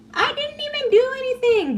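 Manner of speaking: mocking victim voice, very high; Nayeli. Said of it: "she's always playing the victim".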